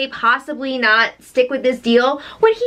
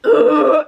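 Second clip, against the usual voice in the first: loud gagging ugh about to vomit; retching ugh; 5 dB louder than usual. Retold or imitated. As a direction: gag like you might throw up. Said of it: "the Josh about to throw up sound".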